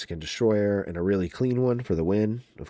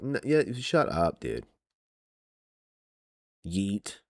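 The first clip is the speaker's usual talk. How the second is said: ordinary talk, nothing marked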